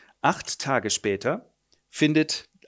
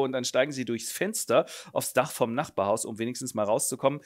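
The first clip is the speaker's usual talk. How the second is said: ordinary talk, nothing marked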